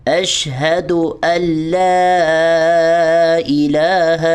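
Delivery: chanted slowly with linked words; slow recitation. Shown as a demonstration. Teacher reciting the corrected phrase. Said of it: "ہم نے حمزہ کو لام سے join کرنا ہے".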